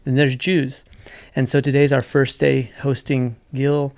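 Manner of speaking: ordinary talk, nothing marked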